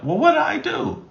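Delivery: high-pitched imitation of a puzzled partner; mock innocent. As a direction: act baffled and innocent. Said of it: "you're like".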